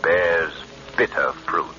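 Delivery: low slow menacing intonation; ominous tagline. Acted. The Shadow warns listeners that crime does not pay, ominous. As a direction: deliver each word like a warning. Closing catchphrase.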